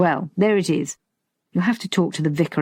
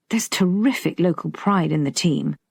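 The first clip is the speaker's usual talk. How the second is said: ordinary delivery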